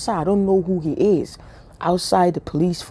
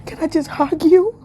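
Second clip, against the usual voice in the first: giddy flirting; 11 semitones higher, 3 dB louder than usual. Retold or imitated. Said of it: "I'm like".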